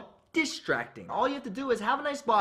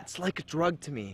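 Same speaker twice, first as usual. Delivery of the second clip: more pained voice; 5 semitones lower than usual. Shown as a demonstration. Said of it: "More pain than that".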